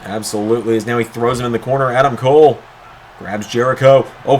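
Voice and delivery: ordinary delivery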